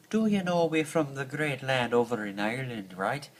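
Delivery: mock Irish lilt; hammed-up Irish brogue; Irish accent. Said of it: "It, it's a bad version".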